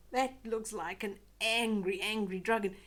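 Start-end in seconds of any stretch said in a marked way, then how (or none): none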